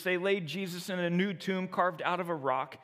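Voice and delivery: ordinary delivery, nothing marked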